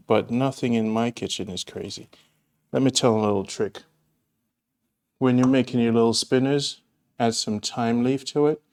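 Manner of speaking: ordinary talk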